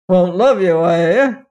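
loud, put-on king's retort; indignant king. A narrator's reading. Wicked king answering his spiteful son, indignant. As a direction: echo it back loudly, affronted.

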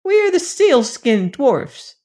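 proud little creatures announcing themselves; sealskin dwarfs. Acted. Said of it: "said the brown people".

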